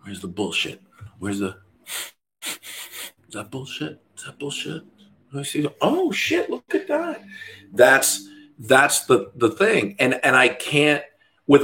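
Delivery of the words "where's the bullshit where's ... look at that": hushed, sneering mock of someone hunting faults; quiet mock inspection, rising to a squeak at the end; mocking a nitpicker. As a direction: mimic a fault-finder peering closely. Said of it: "she's just hawking me out".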